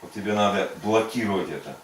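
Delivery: usual speaking voice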